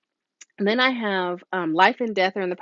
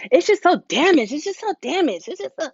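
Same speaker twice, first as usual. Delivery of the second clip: higher mock complaining client; whiny client; 8 semitones higher, 3 dB louder than usual. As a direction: moan about your damaged hair. Client complaining about hair.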